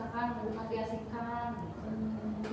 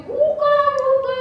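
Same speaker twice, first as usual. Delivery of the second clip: put-on high, slow child's shout to open the door; a little kid pounding the door, calling out; child yelling open; 13 dB louder, slower than usual. Younger child begging mom to open the door, high pleading shout.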